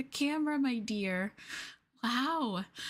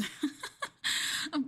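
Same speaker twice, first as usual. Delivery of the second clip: breathy giggle; 4 semitones higher than usual. Acted. Girlfriend giggling over knowing his measurements, breathy laugh. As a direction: giggle at your own secret.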